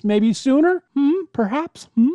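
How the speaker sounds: coy, rising teasing questions. Pretend. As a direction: tease the listeners playfully. Teasing about giveaways.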